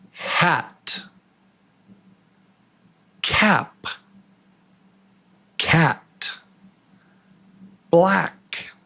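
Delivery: high, half-whispered A-word drill; short A drill. Shown as a demonstration. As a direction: model each word for repeating. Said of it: "Say it with me".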